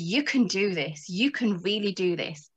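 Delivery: calm the listener like a kind angel on the shoulder; quiet, steady encouragement; gentle intuition. Retold or imitated. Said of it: "my intuition said".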